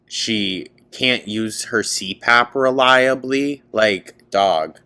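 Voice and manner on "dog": usual speaking voice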